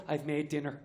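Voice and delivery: a hint of a flat Irish mammy; lightly voiced Irish mother. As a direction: mum announcing dinner, lightly voiced. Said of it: "my mother will go".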